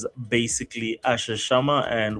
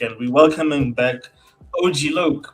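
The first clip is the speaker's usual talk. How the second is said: ordinary delivery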